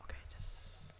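hushed sneaky whisper. Real, not acted. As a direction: whisper so it won't hear. Sneaking past a mob.

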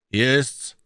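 a grave robber announcing the spot; gruff man. A narrator's reading.

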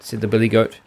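ordinary delivery, nothing marked

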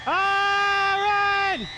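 high, loud scream to run; panicked shout. Real, not acted. Player scared by a monster, shouting in panic.

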